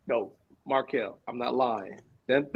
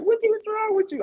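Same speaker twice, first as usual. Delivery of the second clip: shocked shout. Retold or imitated. Kids yelling at screaming dad, a sudden shocked shout. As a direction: bark it out like startled kids. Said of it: "They're like".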